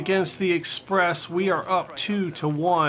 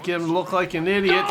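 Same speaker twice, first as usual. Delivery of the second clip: ordinary delivery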